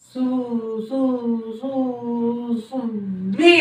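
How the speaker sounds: soft repeated broken starts before the name; stammering son straining to call his wife; stuttering son. Acted. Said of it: "ನೀನ್ ನೋಡಿದ್ರೆ ತೊದ್ಲ".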